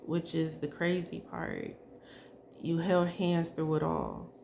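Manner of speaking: ordinary talk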